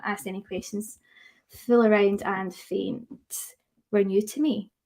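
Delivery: ordinary talk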